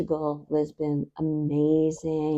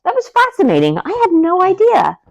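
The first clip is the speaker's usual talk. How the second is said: much higher and louder, thrilled; excited; 8 semitones higher, 6 dB louder than usual. Real, not acted. Friend recalling cork trees, excitedly amazed. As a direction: gush about the cork trees.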